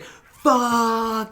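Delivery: high, stretched mock cry; long drawn-out curse. Retelling the hero running with the kid, long drawn-out curse. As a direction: drag the one word out like a slow-motion yell.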